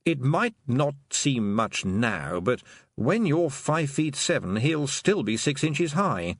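slow, deadpan toad voice; dry toad. Acted. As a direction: drawl it out patiently. Dry toad explaining slowly.

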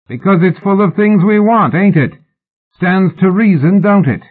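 high, rough-and-ready calling; shouted banter. A narrator's reading. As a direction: holler up as a cheeky rascal.

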